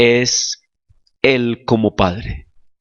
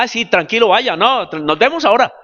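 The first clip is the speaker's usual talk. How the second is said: mock casual; 10 semitones higher than usual. Mocking a casual careless farewell.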